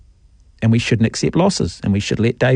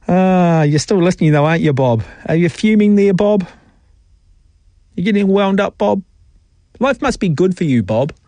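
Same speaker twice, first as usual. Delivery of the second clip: teasing taunt; 6 semitones higher than usual. Acted. Host taunting Bob on air.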